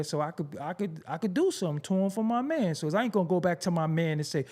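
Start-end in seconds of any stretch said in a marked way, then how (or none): none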